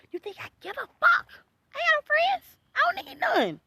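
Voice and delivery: high, loud unhinged rant; ranting crazy person. Acted. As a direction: rave like a madwoman. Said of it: "like a crazy person ranting and raving".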